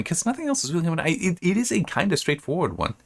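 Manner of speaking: rushed, squeaky self-comment; fast high aside. Real, not acted. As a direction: rattle it off high and quick.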